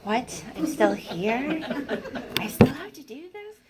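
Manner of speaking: rising, incredulous dismay; disappointed disbelief. Retold or imitated. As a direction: let down to find yourself still alive. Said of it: "this weird sense of disappointment".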